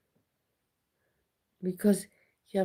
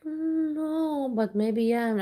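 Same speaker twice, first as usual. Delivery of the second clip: much higher, timid little voice; small-child voice; 8 semitones higher, slower than usual. Retold or imitated. A kid answering her watchful dad, higher and timid. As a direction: squeak it out like a scared kid. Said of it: "I would be like".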